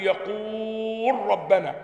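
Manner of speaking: يقول ربنا with the و of يقول stretched slowly and the لام merged into ربنا; slow drilled madd in يقول ربنا; lengthened madd. Shown as a demonstration. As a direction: hold the long و of يقول, then merge into ربنا. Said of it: "فمددت علشان ايه".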